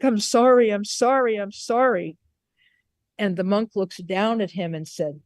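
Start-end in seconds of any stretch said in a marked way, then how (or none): 0.0-2.2 loud pleading, higher, fading each time; weeping apology. Retold or imitated. Kneeling samurai begging the monk's pardon, loud pleading that fades. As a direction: beg forgiveness, easing off with each repeat.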